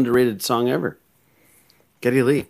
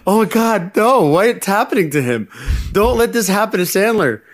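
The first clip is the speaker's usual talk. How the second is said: mock dismay; 9 semitones higher than usual. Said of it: "I was in the theater going".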